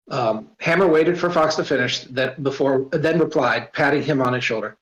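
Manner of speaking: ordinary delivery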